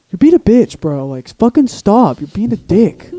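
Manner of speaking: high, loud mock complaint; mock whining protest. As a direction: act out a sore loser protesting loudly. Voices people proved wrong.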